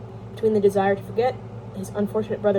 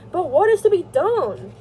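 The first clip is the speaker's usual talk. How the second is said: high, loud, slow helpless question; guilty; 9 semitones higher, 6 dB louder, slower than usual. A narrator's reading. Levin asks his brother what to do, guiltily. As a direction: plead slowly, with a touch of guilt. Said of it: "said Levin guiltily".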